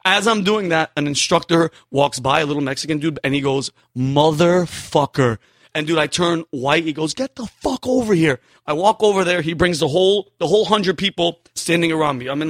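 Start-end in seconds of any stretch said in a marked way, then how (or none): 4.0-5.4 slow, stretched-out disbelief; drawn-out curse. Retold or imitated. Instructor catching a recruit dancing, slow drawn-out curse. 7.1-8.4 higher-pitched imitated instructor command; mock angry order. Instructor summoning the dancing recruit, higher mock-angry order.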